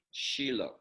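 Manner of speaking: Shiloh said as SHEE-loh; correct pronunciation. Shown as a demonstration. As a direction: say Shiloh with a long ee first. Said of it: "That's how you a-, actually pronounce it".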